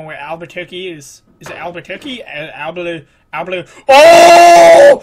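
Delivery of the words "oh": loud, high, sustained scream; startled scream. Real, not acted. Player caught by a mishap, screaming.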